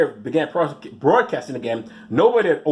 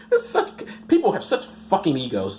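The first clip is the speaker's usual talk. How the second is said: normal talking voice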